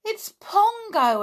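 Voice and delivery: high excited group yell; joyful shout of a name. Acted. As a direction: shout it out in delight. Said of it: "everyone yelled".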